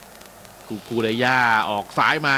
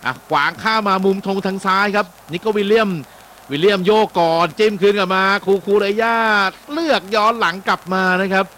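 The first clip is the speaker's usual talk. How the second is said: quick, raised live match call, pitch up; excited play-by-play; 6 semitones higher, 4 dB louder than usual. Real, not acted. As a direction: call the attack as it happens. (พากย์บอลสดอย่างตื่นเต้น เสียงสูงขึ้น)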